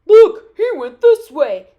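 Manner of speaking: very loud hunters' shout; yelled call. A narrator's reading. Hunters follow the wolf's trail, loud yell. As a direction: holler it to the others. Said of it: "they yelled".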